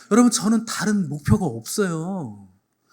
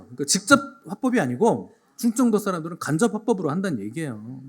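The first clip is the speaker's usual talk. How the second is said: ordinary talk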